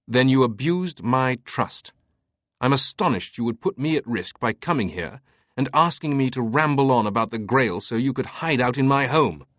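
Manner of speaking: put-on a hard, unsoftened older man; stern Teabing. A narrator's reading. Teabing voiced, accusing.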